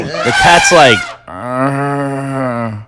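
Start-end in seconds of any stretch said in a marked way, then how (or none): 1.3-2.8 low, soft drawn-out cat groan; imitated cat moan. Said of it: "it's moaning".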